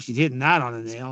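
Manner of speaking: usual speaking voice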